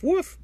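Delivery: sudden high-pitched yappy woof; high dog woof. Shown as a demonstration. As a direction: bark it out like a silly puppy. Voice actor demoing dog bark.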